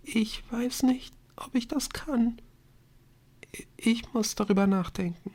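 tiny, high, faltering voice; barely audible. A narrator's reading. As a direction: hesitate softly, almost whispering. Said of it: "war sie kaum hörbar".

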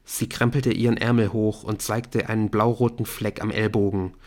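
ordinary delivery, nothing marked

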